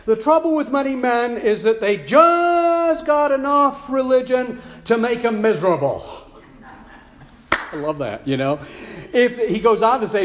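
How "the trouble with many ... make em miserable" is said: preacher quote. Retold or imitated. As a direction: quote the great evangelist with fire. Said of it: "that great evangelist, once said".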